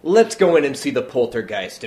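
loud, breezy guide pressing on; cheery tour guide. Retold or imitated. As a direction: carry on brightly as if nothing happened. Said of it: "other tour guide is like".

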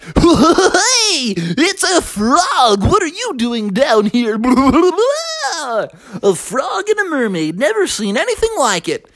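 high, whooping clown voice; goofy clownfish. Acted. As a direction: babble and laugh like a cartoon clown. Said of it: "And they were like".

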